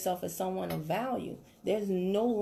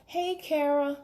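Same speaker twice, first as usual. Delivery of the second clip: bland little "Hey, Kara"; mock a lazy sales opener; weak opener; 7 semitones higher, 3 dB louder, slower than usual. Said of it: "Don't ever message somebody and be like".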